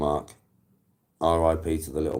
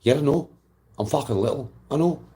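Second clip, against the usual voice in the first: put-on lightly done Scottish accent, higher pitched; a small dog answering in a Scottish accent; 9 semitones higher, 3 dB louder than usual. Tiny dog agrees he is little, high Scottish accent. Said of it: "Scottish accent in it".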